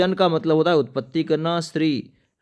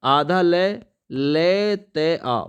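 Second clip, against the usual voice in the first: slow dictated letters; slow spelling out; 2 semitones higher, much slower than usual. Shown as a demonstration. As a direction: spell it out slowly. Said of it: "ये बनेगा ना". (धीरे-धीरे अक्षर गिनाते हुए)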